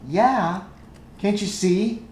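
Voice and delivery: put-on little-girl voice, matter-of-fact; little girl character. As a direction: answer as a small child, pitched well up. Game master answers as little girl.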